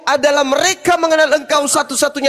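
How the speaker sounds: real high-pitched insistent shout. Real, not acted. Debater shouting scripture over a heckling opponent. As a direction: raise the pitch sharply to override an interrupter. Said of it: "asal teriak aja dia".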